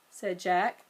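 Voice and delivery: ordinary delivery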